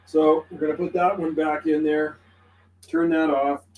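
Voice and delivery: ordinary talk, nothing marked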